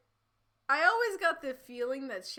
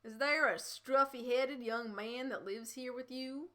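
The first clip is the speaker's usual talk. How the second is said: slow Texan drawl; thick Texan accent; 2 semitones higher than usual. Acted. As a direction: question it slowly like a Texas lawman. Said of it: "drawled in his thick Texan accent".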